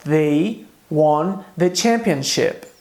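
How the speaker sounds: slow, clear repeat of the example; slow repetition. Shown as a demonstration. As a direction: repeat it slowly for learners. (repetição lenta e clara)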